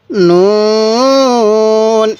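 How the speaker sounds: নুন held very long; elongated recitation. Shown as a demonstration. Teacher reciting for viewers, নুন very slowly drawn out. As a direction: stretch the vowel slowly. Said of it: "এরকমভাবে টান দিব".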